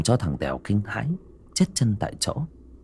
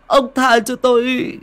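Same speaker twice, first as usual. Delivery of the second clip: higher, louder stammering plea; terrified begging; 9 semitones higher, 3 dB louder than usual. Acted. Tèo begs the ghost to spare him, stammering in terror. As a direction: beg for your life, stuttering. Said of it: "nó mới run rẩy sợ hãi, van xin".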